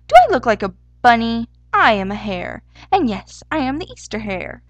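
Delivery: loud, affronted protest; angry indignation. A narrator's reading. Hare corrects the sparrow about being a bunny, indignant. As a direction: come in loud, punching the key words. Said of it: "the hare sniffed angrily".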